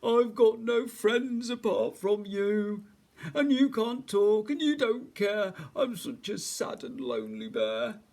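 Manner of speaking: loud, high, woeful wail; whimpering bear. A narrator's reading. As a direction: drag it out like a miserable big bear. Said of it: "he whimpered".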